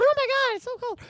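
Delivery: high, whiny cold complaint; mock shivering little girl; squeaky high little-girl whine. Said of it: "I do sound like a little girl".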